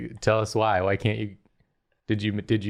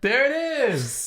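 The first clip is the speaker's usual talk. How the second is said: laughing; slower than usual. Real, not acted. Crowd laughing at the catchphrase.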